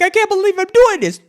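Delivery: high, strained cry of disbelief; panicked high cry at the ledge; disbelieving panic. Retold or imitated. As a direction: pitch it up like you're losing it. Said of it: "I'm like".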